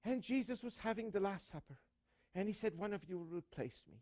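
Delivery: high-pitched Irish priest; Irish accent. Acted. Irish priest preaching the Last Supper at Mass, Irish accent. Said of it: "gets up with his Irish accent".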